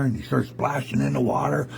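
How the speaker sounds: usual speaking voice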